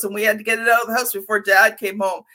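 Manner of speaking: ordinary talk, nothing marked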